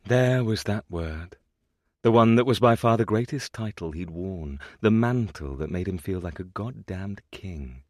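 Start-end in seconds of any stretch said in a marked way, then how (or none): none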